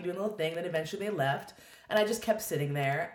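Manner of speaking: normal talking voice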